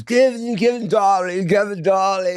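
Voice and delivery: very high, affected calling voice; imitating a friend. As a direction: coo it high, like his exaggerated greeting. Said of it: "He just talks like that".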